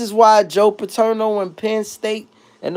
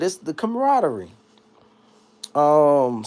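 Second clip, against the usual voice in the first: normal talking voice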